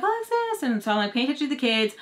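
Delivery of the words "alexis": put-on high sing-song kid call. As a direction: call her name like a little kid. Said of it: "the kids are like".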